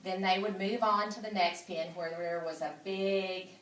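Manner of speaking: ordinary delivery, nothing marked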